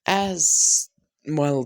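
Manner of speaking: ordinary delivery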